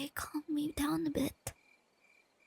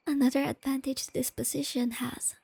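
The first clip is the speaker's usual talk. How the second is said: ordinary talk, nothing marked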